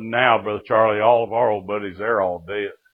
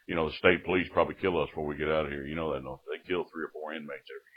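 put-on low, soft, quick old convict; hushed old-timer's warning; old jail inmate; 6 semitones lower, 7 dB quieter, faster than usual. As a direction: mumble it low from the next bunk. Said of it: "he was one of them old".

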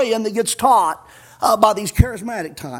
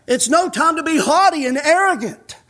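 ordinary delivery

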